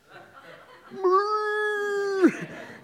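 long, very high, loud moan; imitated moan. Imitating the creepy noise from the woods, loud high moan. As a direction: moan like an imagined Bigfoot. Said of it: "just moaning".

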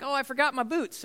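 lightly voiced kid forgetting boots; whiny kid. Kids forgetting things before trip.

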